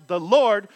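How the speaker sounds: 'The Lord' hit loud with a falling end; stress on The Lord. Shown as a demonstration. Preacher rereading the verse, stressing The Lord. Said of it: "When we encounter the Lord".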